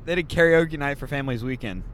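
ordinary talk

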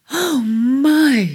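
high, loud breathless oh my; catching her breath. Acted. Cancer gasping in awe at the pearl. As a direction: catch your breath in wonder. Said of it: "she caught her breath".

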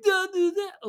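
high, loud nonsense mimicry; silly high-pitched taunt; mocking imitation. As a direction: squawk it back mockingly like the meme. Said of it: "it was like the SpongeBob meme".

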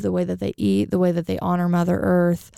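ordinary delivery

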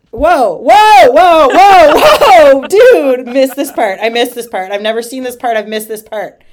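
loud, high outburst of astonishment; real shocked surprise. Real, not acted. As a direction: cry out in disbelief, then ease off slightly. Startled by an unexpected verse while reading.

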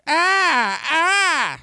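high yelping ah ah; pained cries. Retold or imitated. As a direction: yelp like a wrestler getting cut. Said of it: "That's the noise he definitely makes when he's cut".

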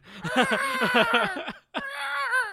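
a huge, high, screaming burst of laughter; shrieking laugh. Real, not acted. Podcaster cracking up at the dinosaur sounds, shrieking laugh. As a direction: howl with laughter until it cracks.

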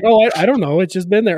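a light imitation of his carefree wife; wife's voice. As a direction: brush it off like it's nothing. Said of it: "she's like".

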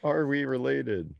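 high, slow mock-innocent voice; mock question. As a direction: ask like a worried cousin. Mocking Iceland app slogan.

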